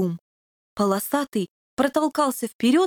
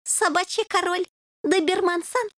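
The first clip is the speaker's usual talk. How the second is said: sad; 8 semitones higher than usual. A narrator's reading. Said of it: "печально ответил Суслик".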